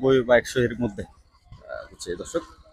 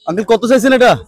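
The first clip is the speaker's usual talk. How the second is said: raised, loud call across the market; 9 semitones higher, 9 dB louder than usual. Real, not acted. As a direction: call out to the seller over the noise. Calling out to a seller.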